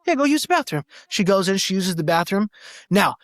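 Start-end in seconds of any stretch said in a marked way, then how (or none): 0.1-0.8 eager agreeing. Retold or imitated. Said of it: "And I'm like".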